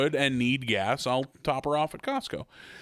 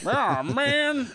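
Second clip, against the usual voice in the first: put-on old man voice; 12 semitones higher than usual.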